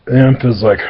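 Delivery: plain reading voice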